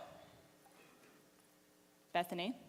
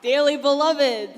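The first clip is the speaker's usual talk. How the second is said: hammed-up officiant; 3 semitones higher, 6 dB louder than usual. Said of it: "I don't know why I'm talking like this".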